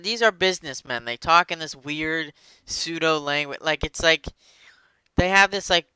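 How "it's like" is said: ordinary delivery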